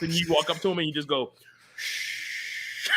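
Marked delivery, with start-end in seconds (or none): 1.8-2.9 soft, breathy shushing sound. Pretend. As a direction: hush someone gently with a long "shh". Imagines shushing an angry person.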